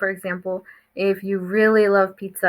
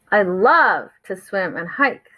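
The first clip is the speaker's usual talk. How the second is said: love drawn out long and punched; stress on love; slower than usual. A narrator's reading. Froggy cheers Duck's lake plans, heavy emphasis on love. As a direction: stretch love, then read on. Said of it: "with more emphasis in our voice".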